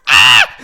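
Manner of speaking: loud surprised shriek; excited scream. Retold or imitated. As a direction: scream with joy. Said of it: "I'm all like".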